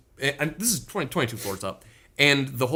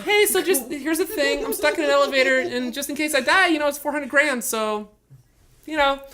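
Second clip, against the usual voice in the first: joking text to wife; 11 semitones higher than usual. Retold or imitated. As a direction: play it light, a wisecrack covering real fear. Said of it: "trying to, like, make jokes about it".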